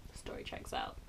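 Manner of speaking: breathy whispered comment; hushed aside. Real, not acted.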